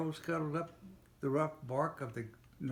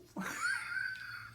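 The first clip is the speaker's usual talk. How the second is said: high breaking laugh; real laugh. Real, not acted. Father laughing while reading. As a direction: burst out laughing mid-story.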